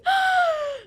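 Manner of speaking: sharp shouted gasp at the cold; big shocked gasp from cold water; loud gasp. Acted. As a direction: gasp hard like icy water hit you. Reacting to icy water.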